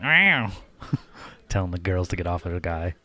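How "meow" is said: high-pitched imitation cat yowl; catty meow. Imitating the girlfriend warning girls off, catty high meow. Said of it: "She's like".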